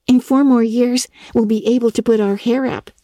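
put-on young Diana chatting brightly; lightly voiced young girl.

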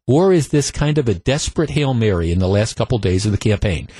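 ordinary delivery

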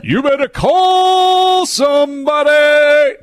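slow, high, loud wrestler bellow; wrestling promo shout. Acted. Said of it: "That actually sounded like it. That's pretty good".

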